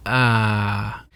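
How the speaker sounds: "Ah" easing downward; falling tone. Shown as a demonstration. Teacher loosening a squeezed hand, "Ah" falling. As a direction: release "Ah" down like an opening hand. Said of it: "let my hand get looser".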